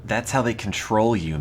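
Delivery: usual speaking voice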